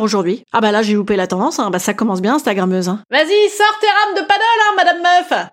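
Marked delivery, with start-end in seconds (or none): 3.1-5.5 indignant taunt. Acted. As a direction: taunt her, pitch way up. La voix outrée la nargue. (raillerie indignée et aiguë)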